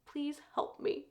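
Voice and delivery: a small, quiet cry for help, retold; weak plea. Captive begging Dane for help.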